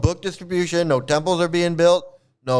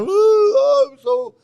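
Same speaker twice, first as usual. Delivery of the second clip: high, louder hammed-up wail; mock crying; 10 semitones higher, 5 dB louder, slower than usual. Mocking everybody running around weeping, high fake wail. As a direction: burst into a fake high sob. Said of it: "pretending to be crying all the time".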